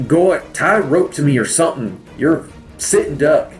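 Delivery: fast, high urgent yell. Acted. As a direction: shout it across the battlefield. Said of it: "and yell at him".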